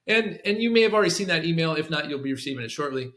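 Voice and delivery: brisk, matter-of-fact aside; matter-of-fact, quick. Shown as a demonstration. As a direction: toss it off quickly, like a sidebar. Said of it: "matter of fact and quickly".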